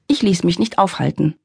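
usual speaking voice

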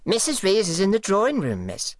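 high, fast, prim housemaid voice; primly. Acted. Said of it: "she said primly".

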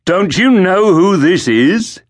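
high, slow, mocking soldier; sneering guard. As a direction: taunt him like a street thug. Dark-haired guard voiced.

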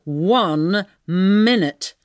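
very slow, drawn-out penetrating command. A narrator's reading. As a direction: make each word pierce through. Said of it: "said the voice penetratingly".

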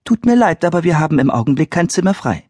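high quick brush-off; polite mother. Retold or imitated. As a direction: rattle it off politely. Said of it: "dass Mama sagte".

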